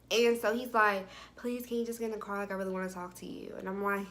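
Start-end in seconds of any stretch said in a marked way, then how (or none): 1.4-3.5 hushed, hurried plea; soft pleading. Retold or imitated. Man begging her to get in and talk, softly pleading. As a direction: plead quickly under your breath.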